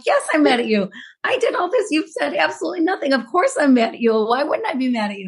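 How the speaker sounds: high-pitched indignant retort, acting out the row; retold anger. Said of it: "getting more and more steamed and angry".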